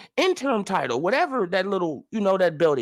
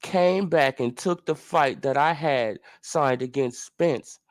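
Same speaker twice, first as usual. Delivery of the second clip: mocking impression of Ugas; 9 semitones lower than usual. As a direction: drag it out in a low, pompous mock-humble tone. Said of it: "So this is what he says".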